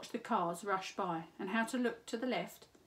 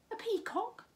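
much higher rising disbelief; surprised cat; 13 semitones higher than usual. A narrator's reading. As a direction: squeak it out in surprise.

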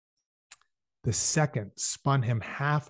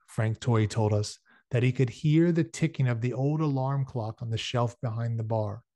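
ordinary delivery

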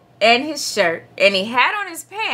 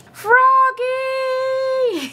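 high, drawn-out mother's call; long singsong holler; 13 semitones higher than usual. A narrator's reading. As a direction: call a child in from far away. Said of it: "his mother called".

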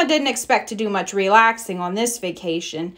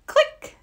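a very high, sharp click sound; camera click. A narrator's reading. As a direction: pop it out like a camera shutter. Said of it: "said the camera".

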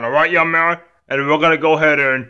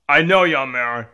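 ordinary talk, nothing marked

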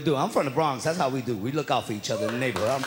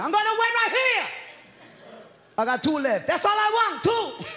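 mock shout. Comic shouting back at the cop about waiting. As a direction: yell it at the cop like a stubborn customer.

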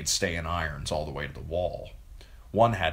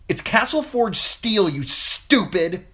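much higher, louder, slow angry retort; snapped; 7 dB louder, slower than usual. A narrator's reading. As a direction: bite back at the bullies. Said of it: "she snapped".

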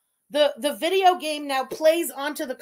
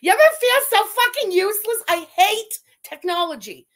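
loud, high, fed-up outburst; exasperated yell; 7 semitones higher, 9 dB louder than usual. Real, not acted. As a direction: let the rage burst out. Venting tech rage on stream.

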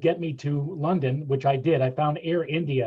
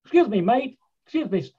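put-on British accent; 7 semitones higher than usual. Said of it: "they really do talk like that".

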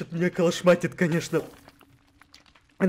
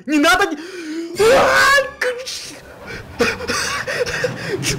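panicked cry; 13 semitones higher, slower than usual. Real, not acted. Panic as suit launches.

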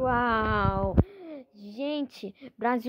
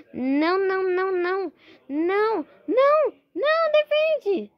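frantic shouting; 7 semitones higher, 5 dB louder than usual. Real, not acted. Panicking as opponent attacks.

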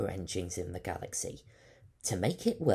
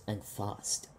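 plain reading voice

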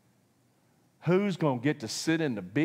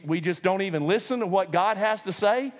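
ordinary delivery

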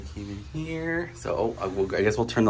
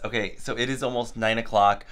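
ordinary talk

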